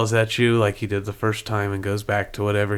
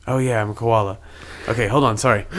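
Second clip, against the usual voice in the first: ordinary talk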